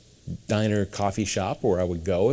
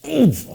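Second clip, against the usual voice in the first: startled oof; 7 dB louder than usual. Retold or imitated. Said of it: "And I go".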